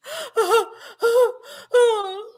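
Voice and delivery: squealing laugh, way up high; high-pitched laughing shriek. Real, not acted. Host cracking up at the chat's spelling challenge, shrieking with laughter. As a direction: burst out in a high, helpless laugh. Reading a funny chat challenge.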